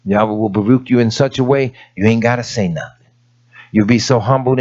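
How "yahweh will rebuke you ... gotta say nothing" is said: usual speaking voice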